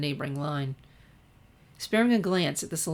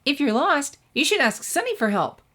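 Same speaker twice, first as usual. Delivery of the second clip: put-on much higher, louder bubbly girl; Pinkie; 9 semitones higher, 5 dB louder than usual. A narrator's reading. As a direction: bounce it out, bursting with cheer. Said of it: "offered Pinky".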